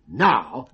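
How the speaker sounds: "now" said loudly and punched. Shown as a demonstration. Mind reader teaches the code, "now" said loudly. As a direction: hit "now" hard and loud.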